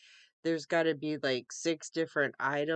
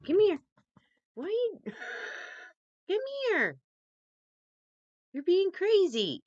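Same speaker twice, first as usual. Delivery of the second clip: very high, louder pet-talk calling; calling the cat; 14 semitones higher, 4 dB louder than usual. Real, not acted. Calling her cat Miles to come, high coaxing pet voice. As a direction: coax the cat over in a high coo.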